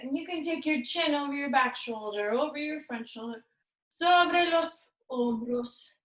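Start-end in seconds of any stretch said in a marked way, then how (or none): none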